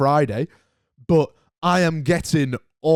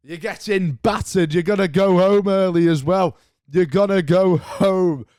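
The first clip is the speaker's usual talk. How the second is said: higher, jeering taunt at rival fans; gleeful taunt; 5 semitones higher than usual. Real, not acted. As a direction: rub it in, mocking the beaten fans.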